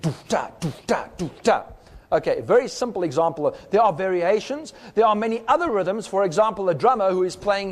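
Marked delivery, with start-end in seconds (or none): 0.0-1.6 vocalized syncopated beat. Shown as a demonstration. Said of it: "that is the rhythm which is used".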